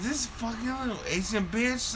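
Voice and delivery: high-pitched retold griping; indignant complaint. Diner complaining to Cam in the car, indignant. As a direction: vent with mock outrage.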